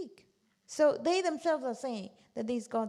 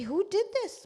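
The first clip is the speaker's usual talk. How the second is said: mock outraged demand; 10 semitones higher, 5 dB louder than usual. Angry crowd finds idols smashed.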